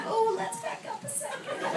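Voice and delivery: a giggly, quiet oh wait; sheepish backpedal. Retold or imitated. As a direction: backtrack fast, half embarrassed. Backpedalling to her gynecologist.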